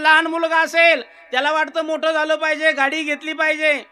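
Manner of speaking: rousing kirtan preaching; preaching at full force. Acted. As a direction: preach it out to the hall.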